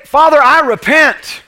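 loud, high fervent preaching prayer; a sample prayer to pray when stuck in the valley; model prayer. Acted. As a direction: cry it out to the Father at full force.

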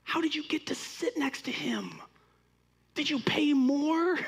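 high breathy whisper of amazement; hushed gossip about the seat; excited whisper. Retold or imitated. Retelling excited whisper to celebrity seat.

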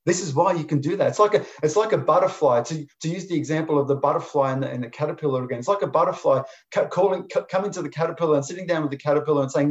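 normal talking voice

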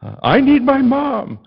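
high, shouted tantrum, words drawn out; mock-tearful high yelling; retold crying child. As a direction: imitate a kid wailing in a stranger's arms. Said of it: "And I'm crying now".

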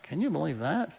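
soft, hushed gossip of disdain; a murmur of disdain in the crowd; whispered criticism. Retold or imitated. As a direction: whisper it to the person beside you. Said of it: "They're just kinda like".

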